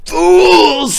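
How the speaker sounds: high, loud furious screech; angry father screeching Fools. Acted. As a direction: screech at the useless doctors. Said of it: "screeched her angry father".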